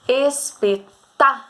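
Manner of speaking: "Espetáculo" said slowly, syllable by syllable; slow sounding out. Shown as a demonstration. Teacher sounding out "Espetáculo" for pupils, slow. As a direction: lay out each syllable of "Espetáculo". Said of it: "falar com calma pra você descobrir".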